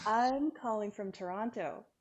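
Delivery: distant, muffled speakerphone sound; muffled phone audio. Real, not acted. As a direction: far off on a speakerphone. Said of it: "You sound like you're underwater fifty feet away".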